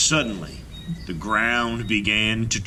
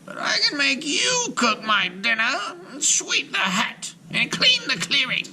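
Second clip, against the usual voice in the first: much higher, crowing witch voice; cackling witch. A narrator's reading. Witch Baba Yaga gloats at captive Marusha, high and crowing. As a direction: gloat over the new servant. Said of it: "The old woman pulled Marusha".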